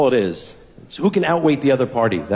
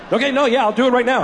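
fake eager; 8 semitones higher, faster than usual. Dad fake eager, high.

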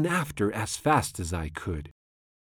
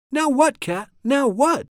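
high, loud storybook exclamation; exasperated; 13 semitones higher, 5 dB louder than usual. A narrator's reading. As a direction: demand it in high-pitched dismay. Exasperated child questions the cat.

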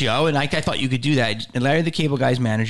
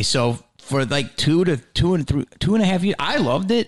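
usual speaking voice

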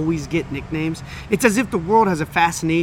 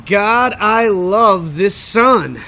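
savouring; 3 semitones higher, 6 dB louder, much slower than usual. Real, not acted. Basking in the sun.

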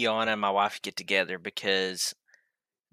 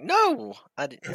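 high-pitched lying voice; slower than usual. Retold or imitated. Husband fibbing to his wife about cleaning, high-pitched. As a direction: voice shoots up high when fibbing. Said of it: "My voice just gets more high pitched".

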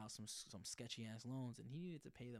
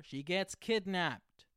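loud, slow, higher cliffhanger announcement; dramatic reveal; 7 semitones higher, 13 dB louder, much slower than usual. Real, not acted. Host revealing the season's ending to listeners, loud and slow. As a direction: drag it out like a shock ending.

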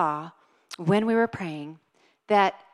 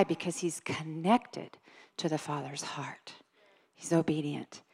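hushed, breathy whisper of the point; 4 semitones lower, 8 dB quieter than usual. Real, not acted. Preacher whispers to the church about Jesus's obedience. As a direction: lean in and whisper it to the congregation.

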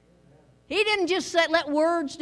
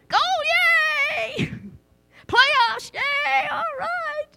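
much higher, excited fan cheer with a laugh; mock cheering; 12 semitones higher, 4 dB louder, slower than usual. Preacher acting out an excited Penguins fan, much higher cheering. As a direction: cheer like a hockey fan at a goal. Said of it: "Do you get excited".

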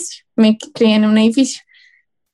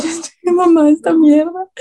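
louder, higher exasperated blurt; fed-up outburst; 5 semitones higher, 4 dB louder than usual. Retold or imitated. Agent at breaking point, fed-up outburst.

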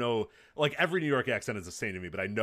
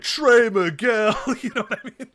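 put-on New York accent; 13 semitones higher, 9 dB louder than usual. Veteran wrestler sneering at a rookie, in a New York accent. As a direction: play a brash New York tough guy calling out a rookie. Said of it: "Rich with the New York accent".

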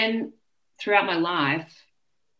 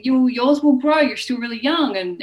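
put-on high, loud, reassuring big-girl voice; teen girl reassuring a worried younger girl; reassuring older girl; 7 semitones higher, 5 dB louder than usual. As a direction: comfort a younger kid brightly and loudly. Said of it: "so she's like".

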